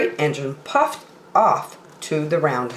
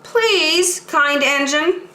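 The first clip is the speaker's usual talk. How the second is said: high, drawn-out begging; the dolls begging the rusty engine, high and pleading; pleading toys; 10 semitones higher, much slower than usual. Acted. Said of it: "cried all the dolls and toys together".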